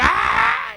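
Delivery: high, loud wail; uproarious acted cry; imitated crowd wailing. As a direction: burst into a mock scream of grief. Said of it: "estaban llorando, había un escándalo".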